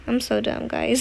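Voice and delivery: ordinary talk, nothing marked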